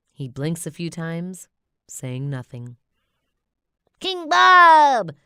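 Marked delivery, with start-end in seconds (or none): 4.0-5.1 much higher, louder triumphant cry; minion shout. Acted. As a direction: shout your own name proudly. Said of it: "he shouts at last".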